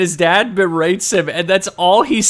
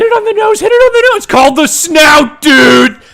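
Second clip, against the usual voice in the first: much higher, louder frantic yelling; hammed-up higher, louder shout at the shark; mock panicked yell; 11 semitones higher, 9 dB louder than usual. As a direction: shriek orders in a panic. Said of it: "one of them just goes".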